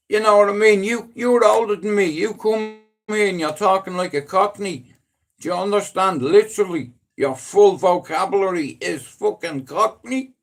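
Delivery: loud, high ranting jab; half-joking furious rant; mock-angry rant. Real, not acted. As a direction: rip into your mate for going Cockney. Ranting at friend gone Cockney.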